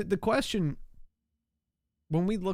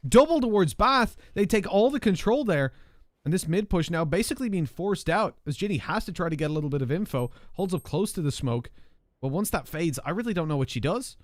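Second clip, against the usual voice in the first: esports caster; 5 semitones higher than usual. Acted. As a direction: call the round as it unfolds. Live round play-by-play.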